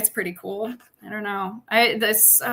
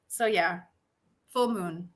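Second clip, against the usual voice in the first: ordinary talk, nothing marked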